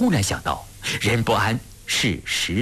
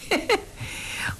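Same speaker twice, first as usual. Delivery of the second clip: short, high, soft fake chuckle; dry forced laugh; 5 dB quieter than usual. A narrator's reading. Cornered officer answers the commander with a dry laugh. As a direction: give a small uneasy polite laugh. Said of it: "干笑了一声".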